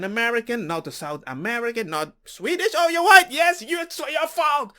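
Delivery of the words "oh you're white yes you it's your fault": loud, pointed mock accusation; mock accusing shout. Mocking an accuser.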